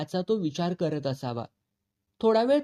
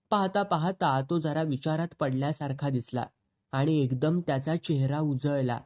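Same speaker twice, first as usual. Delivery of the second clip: usual speaking voice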